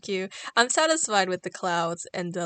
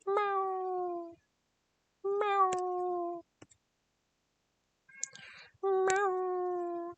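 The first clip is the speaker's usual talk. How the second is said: high, long meow; drawn-out meow; 9 semitones higher, much slower than usual. Pretend. Streamer calling to her cat behind the door, high meow.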